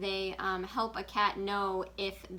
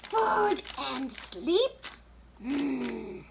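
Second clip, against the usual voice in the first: very high, slow and louder puppet reply; slow puppet cat voice; 6 semitones higher, 4 dB louder, much slower than usual. Pretend. Puppet Mittens naming her motivation, very high and slow.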